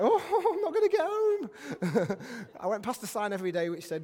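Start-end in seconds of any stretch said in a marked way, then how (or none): 0.0-2.2 high, loud, drawn-out comic wail; hammed-up panicky moan; mock terrified. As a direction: wail it in exaggerated dread, half laughing. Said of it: "We were terrified".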